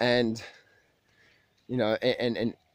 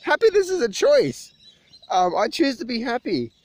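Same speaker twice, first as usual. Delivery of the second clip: high excited declaration; 10 semitones higher, 4 dB louder than usual. Retold or imitated. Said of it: "I'm like".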